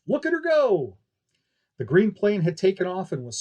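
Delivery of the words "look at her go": much higher, louder showman exclaim; excited. Acted. Barker thrilling the crowd as she flies, excited cry. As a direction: point them to the sky with a thrill.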